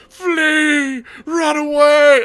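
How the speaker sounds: hammed-up soldiers shouting to run; mock panicked yell. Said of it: "at the bottom, it's just".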